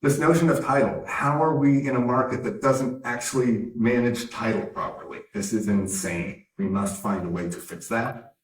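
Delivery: usual speaking voice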